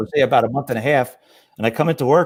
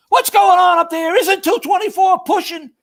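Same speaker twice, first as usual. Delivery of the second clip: much higher, louder mock shout; retold shout; 5 dB louder than usual. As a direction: bellow up the stairs demanding answers. He's going.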